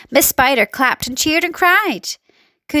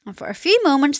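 ordinary delivery

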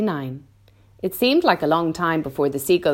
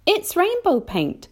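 much higher than the narration; James answering. A narrator's reading. James explains the paint to the grasshopper, in a high character voice. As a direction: lift the voice into a young hero's explanation.

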